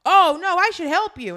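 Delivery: high, loud startled oh no; mock sudden realization. Bystander realizing Hyunwoo is trapped, high and startled. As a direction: gasp it out as it dawns on you. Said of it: "He's like".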